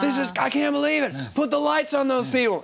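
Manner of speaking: outraged frontman on the mic; hammed-up rocker calling for the lights. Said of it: "And then went".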